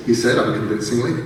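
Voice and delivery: ordinary delivery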